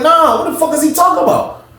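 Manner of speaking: high, indignant demand; angry challenge. Acted. Storyteller acting out refusing his friend's plea, indignant.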